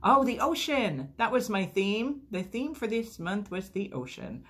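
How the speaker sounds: high, loud happy gush; thrilled to see her theme; delighted. Real, not acted. Vlogger thrilled her box theme is the ocean, high and excited.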